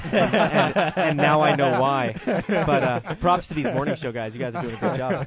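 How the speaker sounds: laughing through the words; chuckling loudly while talking. Real, not acted. Laughing while praising the guests.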